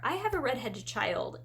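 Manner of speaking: ordinary delivery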